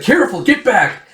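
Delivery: high-pitched warning call; acted alarm, voice jumping up; urgent warning. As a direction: warn them away from danger. Villagers warn each other under attack.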